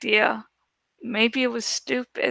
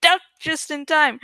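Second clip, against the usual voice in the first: plain reading voice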